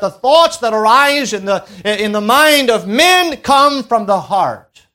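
full-force sermon voice; fervent preaching. Acted. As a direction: drive it home to the congregation.

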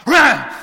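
loud high mock dog snarl; imitated growl. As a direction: bark like an angry dog.

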